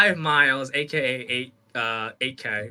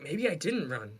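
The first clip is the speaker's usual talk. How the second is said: retold self-doubt; 11 dB quieter than usual. Gaslit into doubting himself.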